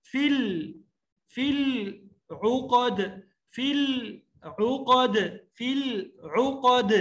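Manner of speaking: slow فی العقد, heavy ق and echoing د; thick qaf, bouncing dal. Shown as a demonstration. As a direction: bounce the final د. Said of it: "قاف کو موٹا ... دال ... قلقلہ".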